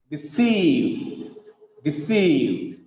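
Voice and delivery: "deceive" with a long, falling CEIVE; de-CEEEIVE, short first beat, long second; stress on second syllable. Shown as a demonstration. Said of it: "The second syllable is stressed here".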